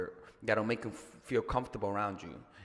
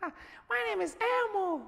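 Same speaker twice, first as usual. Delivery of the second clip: very high, slow, puppet-like Elmo voice; slower than usual. Retold or imitated. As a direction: squeak it out like the red Muppet. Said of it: "I'm like Elmo".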